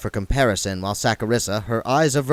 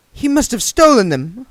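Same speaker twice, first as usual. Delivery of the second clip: put-on higher, louder girl's voice; young woman voice; 13 semitones higher, 8 dB louder than usual. A narrator's reading. Saccharissa accuses the dead man, higher and louder.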